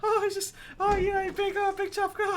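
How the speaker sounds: high, simpering imitation of a fawning guy; simpering mock voice. Telling a friend about a fawning stranger, high simpering voice. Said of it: "That guy was properly like".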